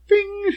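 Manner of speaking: high-pitched "bing" sound effect; ping it out like lights powering up; bright little startup chime noise; imitated switch-on ding. Imitates eyes switching back on.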